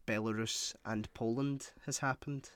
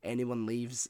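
normal talking voice

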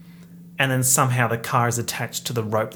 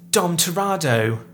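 loud, higher Cockney greeting; Cockney accent; 4 semitones higher, 5 dB louder than usual. Retold or imitated. Vlogger mimicking Helen Mirren in the movie, loud Cockney accent. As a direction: put on a brash Cockney accent. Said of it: "distressing Cockney accent".

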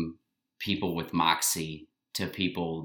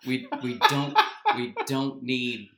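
wedding planner voice; 5 semitones higher than usual. Retold or imitated. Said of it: "You're like".

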